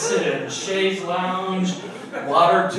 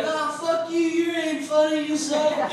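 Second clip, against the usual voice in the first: high, mocking heckler yell; drunk heckler; 11 semitones higher, 3 dB louder than usual. Drunk heckler yelling at comic.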